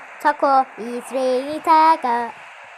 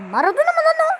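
surprised; 5 dB louder, much faster than usual. Real, not acted. Surprised at opponent player.

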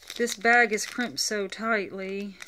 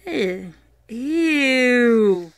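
loud disgusted ew; 2 semitones higher, 9 dB louder than usual. Real, not acted. Disgusted at gross toy.

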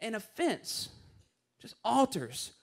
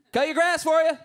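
higher, louder friendly hail across the yard; calling out; 6 semitones higher, 8 dB louder than usual. Retold or imitated. Elderly neighbor calls out to the pastor, higher and louder hail. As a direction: call across the driveway.

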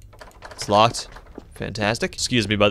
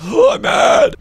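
very high, louder shrieking I'm mad; mock rage squeal; 5 dB louder, slower than usual. Streamer admitting he is mad in a shrieking voice. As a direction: shriek it in hammed-up fury. Answers own question, exploding.

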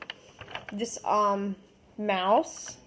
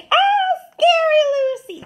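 mock scared cry; 8 dB louder than usual. Kid pretending her Lucy drawing is scary.

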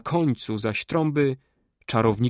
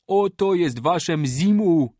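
much higher, louder, slow, triumphant call; triumphant shout; 6 semitones higher, 6 dB louder, slower than usual. A narrator's reading. Kali throwing the drum at Mruła, triumphant laughing shout. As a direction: throw it at their feet in mocking triumph. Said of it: "zawołał z wielkim śmiechem".

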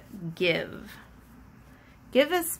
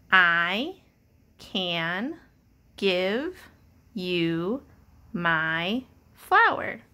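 each word spaced out slowly, pausing between; word-by-word reading; much slower than usual. Shown as a demonstration. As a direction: read it one word at a time for a child. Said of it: "Read it with me".

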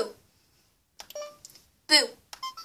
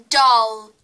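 loud, deliberate "Doll"; loud clear word; 3 semitones lower, 12 dB louder than usual. Shown as a demonstration. Said loud for recording.

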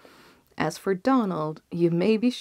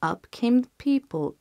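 ordinary delivery

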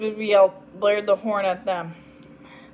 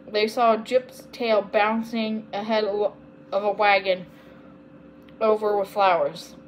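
usual speaking voice

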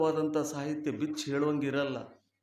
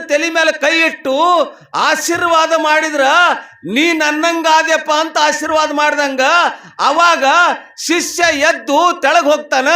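high, loud sustained sermon delivery; full-voiced preaching; 12 semitones higher, 13 dB louder than usual. Real, not acted. Preacher explaining guru initiation to devotees, full-voiced preaching. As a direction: proclaim it to a whole congregation.

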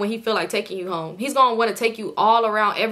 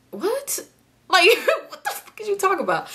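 high, squeaky mock disbelief; incredulous; 10 semitones higher than usual. Passenger reacting to talk of a drive-by, high incredulous squeal. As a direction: blurt it high in baffled disbelief, half laughing. Acting out her shocked reply.

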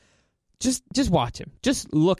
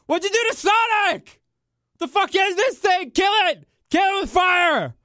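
high, screamed mock panic; horrified audience; slower than usual. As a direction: shriek like an appalled crowd seeing a monster. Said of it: "the audience's reaction when they saw Sonic".

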